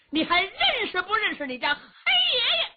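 high, fast, loud mocking yell; taunting bellow. Xiong Kuohai taunting Yuwen Chengdu, a loud high jeer. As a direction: jeer at him across the field.

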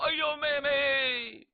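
brother's drawn-out tearful greeting; tearful cry. A narrator's reading. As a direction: weep out her name. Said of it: "马三宝也哭了呀".

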